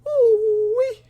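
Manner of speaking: high, loud ooh-wee; excited hoot. Real, not acted. Hooting at a high rating.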